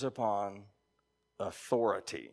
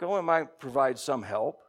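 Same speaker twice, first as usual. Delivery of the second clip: usual speaking voice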